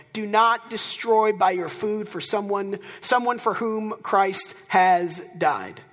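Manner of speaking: slow, weighty reading of the verse; slow and deliberate. Shown as a demonstration. Pastor lingering on the verse for the congregation, slow and deliberate. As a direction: let each word land slowly. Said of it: "I love that last line".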